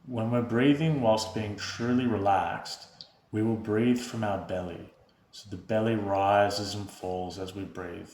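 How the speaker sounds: soft, unhurried meditation voice with long pauses; guided meditation. Real, not acted. Host leading viewers through breath and body awareness, calm guided meditation. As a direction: guide them gently into stillness, hushed and even.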